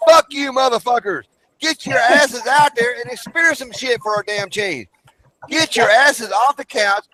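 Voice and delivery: high-pitched angry venting; furious rant. Real, not acted. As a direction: tell the couch critics off at full steam. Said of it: "I'm pissed off at these damn people".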